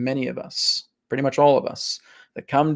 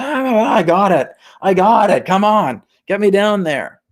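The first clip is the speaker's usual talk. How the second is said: high, loud, hammed-up eagerness; impatiently excited; 8 semitones higher, 5 dB louder than usual. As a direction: burst out like a soul desperate to be let go. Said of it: "That's what you're like".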